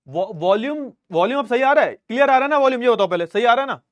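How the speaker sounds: projecting loudly into the mic. Shown as a demonstration. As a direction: push the voice up so the class can hear. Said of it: "आवाज slow आ रही है".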